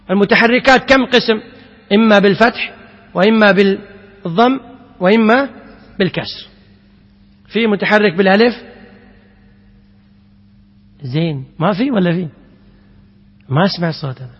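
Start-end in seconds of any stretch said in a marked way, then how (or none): none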